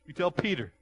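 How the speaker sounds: loud, high, insistent command; booming voice of the Lord, hammed-up; emphatic divine voice. Preacher acts the Lord emphatic.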